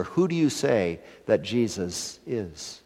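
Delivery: ordinary delivery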